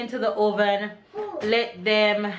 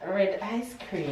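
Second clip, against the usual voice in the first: slow, clear repeat; 4 dB quieter than usual. Real, not acted. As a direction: spell it out gently, word by word.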